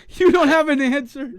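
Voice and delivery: laughing high through the question; can't believe he dodged it, laughing; laughing disbelief. Real, not acted. Host laughing at the guest's non-answer, high and giggly.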